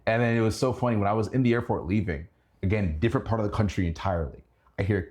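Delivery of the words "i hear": usual speaking voice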